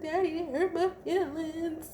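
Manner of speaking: put-on high, small toddler voice; a wounded toddler telling her dad; little girl. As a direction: imitate a hurt little girl. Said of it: "this little girl".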